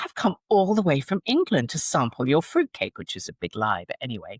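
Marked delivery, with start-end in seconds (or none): 0.0-3.0 put-on raised-pitch, emphatic British accent. Customer talking bakery staff into a free sample, British accent. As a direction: lay on the British accent to persuade. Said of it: "I said in my British, British accent".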